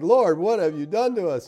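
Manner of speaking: a hammed-up cry of dismay to the Lord; mock scream. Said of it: "'Cause I will be screaming".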